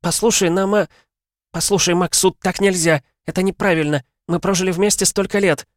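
high, loud pleading wife; a wife begging her husband, high and loud. A narrator's reading. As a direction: beg him not to leave.

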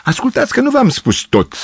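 Gavrilescu's voice pitched high and pleading; pathetic cry. A narrator's reading. Gavrilescu pleads pathetically with the girls, high and theatrical. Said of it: "strigă patetic Gavrilescu".

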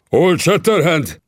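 startled chief shouting the name; shouted name. Acted. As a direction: cry out the name in shock. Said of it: "kiáltotta".

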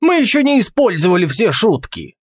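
high-pitched, restrained narrator's shout; indignant call. A narrator's reading. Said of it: "крикнул он".

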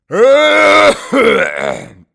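harsh hacking cough, very loud; old man's coughing fit. Acted. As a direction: cough like a sick old man. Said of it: "переставший, наконец, кашлять".

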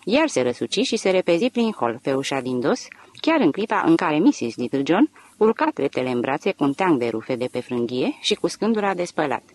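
ordinary delivery

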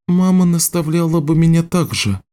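put-on high-pitched girl agreeing; young apprentice, pitched well up; young girl's voice. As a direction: answer in a high girlish voice. Girl answers Richard's advice.

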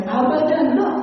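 high, firm never; shouted never. Retold or imitated. Young man shouts never about his aunt. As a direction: cry out a final refusal. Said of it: "Teriak dia tidak".

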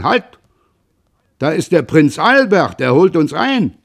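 loud, high excited cry; sudden call. A narrator's reading. Father spotting the steamer, sudden loud call. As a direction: call out to the whole boat all at once. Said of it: "Vater Roland rief auf einmal".

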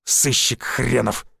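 slow, grumbling under the breath; displeased. A narrator's reading. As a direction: mutter it slowly with contempt. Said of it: "недовольно произнёс Евгений Александрович и добавил". (недовольно, медленно)

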